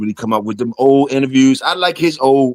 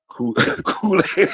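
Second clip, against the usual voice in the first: laughing while reading; 4 semitones higher, 3 dB quieter, slower than usual. Real, not acted. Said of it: "Ben said".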